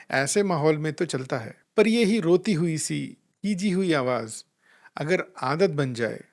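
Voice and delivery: usual speaking voice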